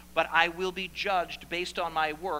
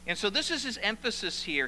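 normal talking voice

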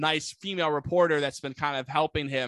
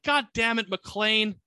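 higher-pitched, hammed-up exasperation; mock exasperated curse; 6 semitones higher, 3 dB louder, much slower than usual. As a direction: curse your nemesis in mock anger. Villain curses the returning hero.

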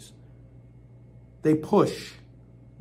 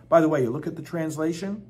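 normal talking voice

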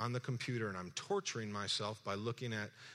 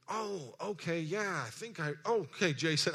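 confused recognition; 7 semitones higher, 5 dB louder than usual. Retold or imitated. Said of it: "I'm a little confused".

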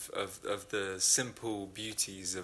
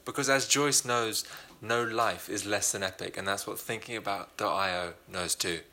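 upbeat, louder hype of an ad spot; sponsor read; 6 dB louder than usual. Acted. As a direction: sell it like a playful announcer. Host reads sponsor ad.

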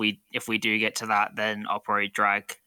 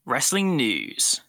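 announcer voice; 2 semitones higher, much slower than usual. Acted. Announcing the segment.

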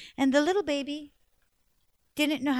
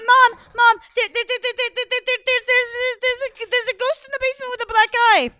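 high, loud frightened stuttering; boy terrified after seeing ghost; panicked stammer; 10 semitones higher, 9 dB louder than usual. Acted. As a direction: stammer in terror.

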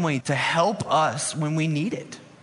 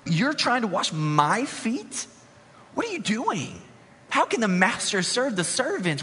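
astonished Peter; 5 semitones higher than usual. Retold or imitated. Peter shocked as Jesus kneels to wash his feet, high alarmed protest. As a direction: play Peter, stunned and pushing back. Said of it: "So Peter says".

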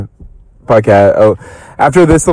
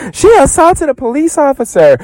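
high mock-scandalized squeal, much higher; mock Tinsley. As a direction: ham up a shocked gossip. Quoting Tinsley gossiping about Luann.

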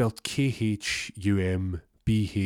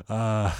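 acted group laugh at someone; imitated mocking laughter; 2 semitones higher than usual. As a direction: mimic the lads laughing at him, in two bursts. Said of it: "everyone's laughing at him, like".

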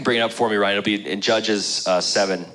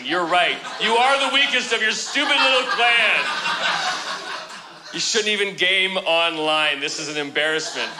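hammed-up exasperated rant, higher and laughing; mock angry God; 7 semitones higher than usual. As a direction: play God fed up and mocking, through laughter. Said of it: "is he doesn't say".

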